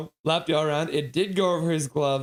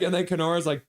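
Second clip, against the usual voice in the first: ordinary delivery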